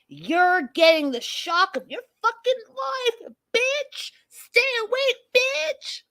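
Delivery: hammed-up high screechy tirade, stretched out; exaggerated high-pitched threat, climbing as it goes; mock aggressive. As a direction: imitate her raging at a very high pitch. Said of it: "all aggressive".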